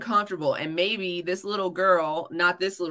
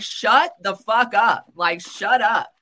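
louder, higher, drawn-out shut-up; retold angry command; 3 semitones higher, 5 dB louder, slower than usual. Retold snapping at crying cousin.

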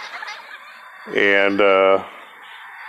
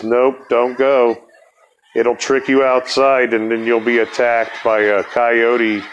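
slow, sleepy warning; half asleep; slower than usual. Retold or imitated. A half-asleep friend warns the narrator not to go outside, slow and sleepy. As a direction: drag the words out like someone barely awake. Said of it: "while she's half asleep".

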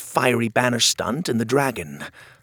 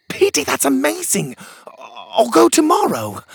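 high, excited burst; can't contain the joy; sudden excited shout; thrilled; 6 dB louder than usual. A narrator's reading. Pockets thrilled.

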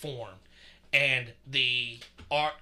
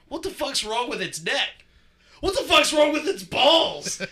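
shocked outburst; 11 semitones higher, 6 dB louder than usual. Retold or imitated. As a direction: blurt it out, more shocked with each question. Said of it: "And Tyler's like".

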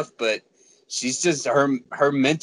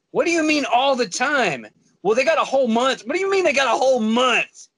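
pitched-up disbelief, mock outrage; incredulous retort; 6 semitones higher than usual. Boyfriend retelling his disbelief at his girlfriend's complaint. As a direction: push back in disbelief, rising higher. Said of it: "It's like".

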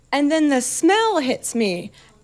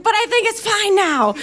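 high-pitched mock tipsy insistence; tipsy reassurance; 8 semitones higher than usual. Tipsy videographer covering up to the cater waiter, high and insistent. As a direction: insist all is fine, pitched well up, like someone tipsy. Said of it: "being tipsy".

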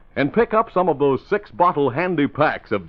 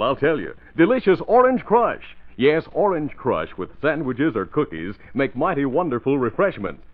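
bright, eager radio ad read; chipper 1950s ad. Acted. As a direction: sell it with cheerful pep. Announcer reads an Orange Crush ad.

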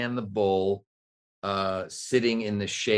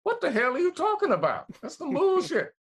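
much higher, fast, outraged squeak; surprised high Morgan Freeman. Pretend. Morgan Freeman shows his surprised voice, very high and fast. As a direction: jump way up in pitch, shocked. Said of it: "my voice goes up high when I'm surprised".

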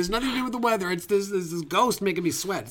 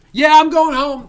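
louder, gruff security guard; 8 semitones higher, 10 dB louder than usual. Pretend. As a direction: bark it like a sulky guard. Voices Jerry leaving.

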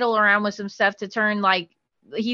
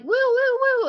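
high wailing woo-woo siren sound; imitated police siren; 12 semitones higher than usual. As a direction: wail it like a cop car arriving. Police cars pulling up outside.

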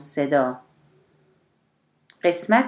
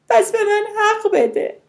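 very high, loud appeal; desperate plea; 5 dB louder than usual. A narrator's reading. Mother begging her sister to see her side, high wail. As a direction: wail it as a last demand. Distraught mother demands understanding.

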